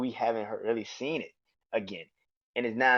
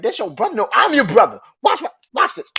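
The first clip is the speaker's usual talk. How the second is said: higher and louder, defiant; taunting Jimmy; 10 semitones higher, 11 dB louder than usual. Retold or imitated. Jimmy claiming his brother before the attack, loud taunt. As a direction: spit it out as a dare. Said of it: "Jimmy like".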